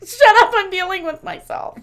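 loud, high-pitched retold outburst; mock yell to get someone off your back; exasperated comeback. Snapping back at sister asking about work, exasperated.

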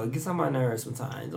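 ordinary talk

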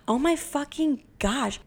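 slow, high-pitched amazement; acting out being stunned, drawn out; retold astonishment. Client stunned the psychic knows her childhood, amazed.

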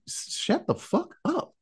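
high, slow, hushed mock command; acted urgent hiss, kept quiet; urgent hushing. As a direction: shush a panicking companion under your voice. Retold hushing of a panicking companion.

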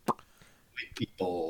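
breathy whispered punchline; whispered aside. Acted. Cohost whispers a sneaky joke about Maine locals, whispered. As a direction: whisper it under the talk like a secret.

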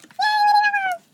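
a playful, drawn-out cheer; mock cheer. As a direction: cheer the segment title like a kids' show host. Cheering the segment title.

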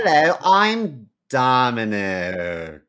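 slow, drawn-out British introduction; mock-jolly British accent; imitated British accent. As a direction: imitate a jolly British friend, stretching the words. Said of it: "wasn't as jolly and British".

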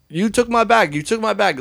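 mock yell, pitched high, accusing; retold yelling. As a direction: shout the accusation in a high pitch, imitating him. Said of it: "starts yelling".

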